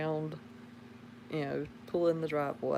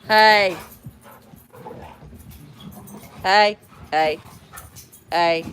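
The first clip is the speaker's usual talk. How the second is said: mama voice; 2 semitones higher, 12 dB louder, much slower than usual. Real, not acted. Mom shouting at the kids to stop. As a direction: yell to break up rough play. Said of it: "had to use my mama voice".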